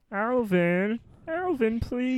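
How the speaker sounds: very high pleading whine; whiny voice. Pretend. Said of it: "whiny voice".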